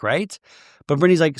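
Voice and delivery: ordinary talk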